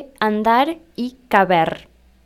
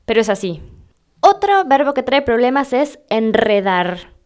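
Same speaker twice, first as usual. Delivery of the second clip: normal talking voice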